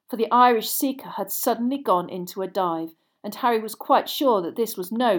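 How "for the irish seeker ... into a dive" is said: ordinary delivery, nothing marked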